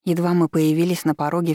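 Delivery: ordinary delivery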